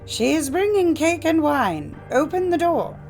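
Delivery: high, loud put-on girlish voice; wolf posing as girl. A narrator's reading. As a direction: call through the door pretending to be the child. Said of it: "replied the wolf".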